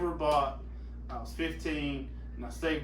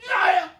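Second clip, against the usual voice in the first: short high, loud holler; re-enacted yell; 9 dB louder than usual. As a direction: burst out with a mock scream. Re-enacts his own yell.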